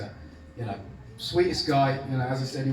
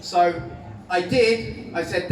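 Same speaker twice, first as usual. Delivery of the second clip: ordinary talk, nothing marked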